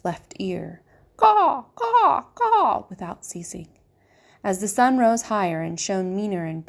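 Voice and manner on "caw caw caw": high, loud screeching crow caws. A narrator's reading. Crow screaming into the rabbit's ear, high harsh caws. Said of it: "screamed a dark message".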